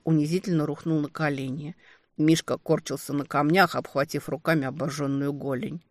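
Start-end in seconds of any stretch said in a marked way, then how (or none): none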